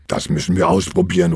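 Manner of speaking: usual speaking voice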